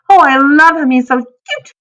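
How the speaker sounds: high, loud burst of adoration; squeal-like excitement, pitched right up; excited gushing. A narrator's reading. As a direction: gush like a smitten little girl. Child gushing over giant dog.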